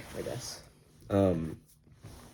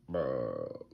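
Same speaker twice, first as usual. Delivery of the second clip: low, soft, spaced-out "bruh"; mock out-of-it mumble; dazed dad; 8 semitones lower, 7 dB quieter than usual. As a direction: drop low and blank like a zombie. Dazed father voiced.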